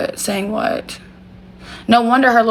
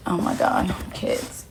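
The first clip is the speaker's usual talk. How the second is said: whisper; 11 dB quieter, slower than usual. Real, not acted. Hushed aside near sleeping pets.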